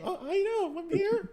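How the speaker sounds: put-on high, soft kid answering; reply like a cornered kid; meek kid reply.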